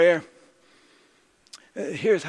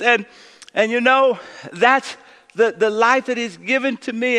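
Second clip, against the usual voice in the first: raised, driving preacher voice; preaching at full force; 5 semitones higher, 5 dB louder than usual. Acted. Pastor closing his sermon to the congregation, preaching hard. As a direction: build the close of the sermon from the pulpit.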